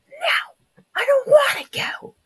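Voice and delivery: very high, loud British whine; British child whining. Acted. Little brother refusing to go, high British-accented wail. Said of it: "I just suddenly turned British".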